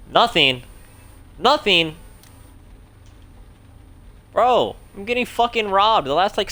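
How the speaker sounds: loud, exasperated cry at the game; frustrated shout. Real, not acted. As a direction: yell it out in real frustration. Streamer unpaid by fares again.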